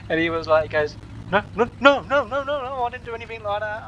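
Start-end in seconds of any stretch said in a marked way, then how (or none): 1.3-3.8 high, fast flustered "no no no"; a mock-panicked old man denying it, falling over his words; panicked denial. Friend retelling how Ray denied sleeping with his ex, panicked high denial.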